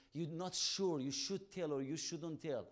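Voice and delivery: ordinary talk, nothing marked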